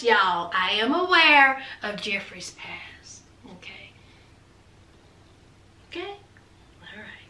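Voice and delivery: slow, hushed whisper to the audience; a slow, knowing whisper; whispered aside. Real, not acted. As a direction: lean in and whisper it confidentially. Confidential aside to the viewers.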